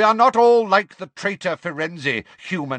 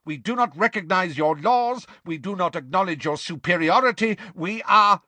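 proud, raised-pitch tirade; indignant shouting; 6 semitones higher than usual. Acted. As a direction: declaim with offended pride, building until cut off. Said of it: "shouted the grey centaur".

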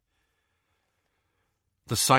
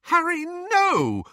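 much higher, slow desperate plea; begging; 4 dB louder, slower than usual. Acted. Hermione begs Harry to stop, desperate high cry. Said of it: "Hermione begged him".

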